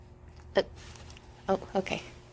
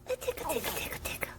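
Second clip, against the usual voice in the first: fading to an unvoiced whisper; hushed teasing whisper; whispered tickles; 3 semitones higher, 14 dB quieter, much faster than usual. Real, not acted. Tickling the baby.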